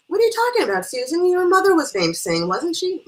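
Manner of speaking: high, loud, insistent questioning; excited challenge. Acted. Guest contradicting hostess about her mother, excited insistence. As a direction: press the point loudly at a friend.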